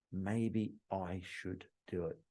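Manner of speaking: low, slow, very soft thought; musing to oneself. Acted. Customer weighing what everyone else does, quiet slow musing. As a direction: think it aloud softly, drawing it out.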